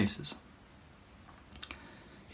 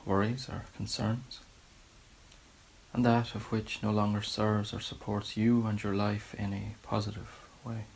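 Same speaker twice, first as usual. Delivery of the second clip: softer, unhurried meditation guidance with long pauses; guided meditation; 6 dB quieter than usual. Real, not acted. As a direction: gently settle listeners into a body scan, leaving space between phrases. Leads guided body scan meditation.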